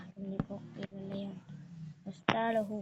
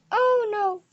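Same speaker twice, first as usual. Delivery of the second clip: surprised shout; 11 semitones higher, 12 dB louder than usual. Real, not acted. Falling again in game.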